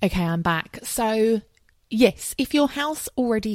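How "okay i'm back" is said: normal talking voice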